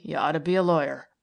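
quick, lightly teasing male voice; a hint of a wry man teasing; Tom's voice. Tom teasing Milly.

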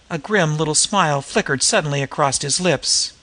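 usual speaking voice

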